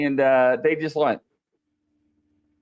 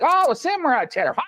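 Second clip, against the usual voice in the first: excited retold reply; 12 semitones higher than usual. Retells own excited reply at salon.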